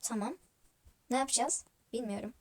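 usual speaking voice

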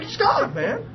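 high, fervent preaching to the doctor; high excited mock sermon. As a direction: proclaim it like a street preacher. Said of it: "there I am preaching to the doctor".

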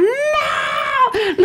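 high, crying shout of no; fan's anguished yell. Retold or imitated. Soccer fan screaming at the match, anguished high shout. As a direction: scream it like a fan at a lost goal. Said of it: "Es como gritan".

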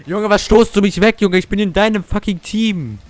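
loud, high angry rant; furious complaint. Real, not acted. Gamer yelling at a teammate who bumped him away, angry. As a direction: snap at the teammate who knocked you off.

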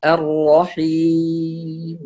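drawn-out correct الرحيم; correct reading. Shown as a demonstration. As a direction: say الرحيم slowly with a full kasra. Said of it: "فالصحيح نقول". (النطق الصحيح)

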